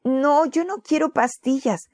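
high whiny refusal; a child refusing medicine, whining; complaining. Acted. Boy refuses pills from his mother, high whiny complaint. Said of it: "se quejó Timoteo".

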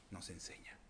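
whispered ending; hushed whisper. Acted. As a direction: let it fall to a whisper. Whispered close.

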